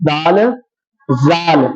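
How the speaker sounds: teacher voicing a wrong reading; common mispronunciation. Shown as a demonstration. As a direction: model the error زال. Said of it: "غلط پڑھتے ہیں ہم".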